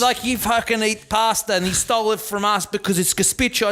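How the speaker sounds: ordinary talk, nothing marked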